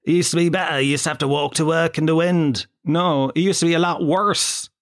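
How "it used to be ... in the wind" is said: put-on English accent, nostalgic; mock English Brexiteer. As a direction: hark back to better days in an English accent. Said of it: "in the mind of a Brexiteer".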